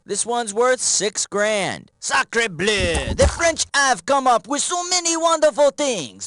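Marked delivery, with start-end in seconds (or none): 2.0-6.3 hammed-up French accent, a bit higher; playful French chef voice. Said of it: "time to make fun of the French".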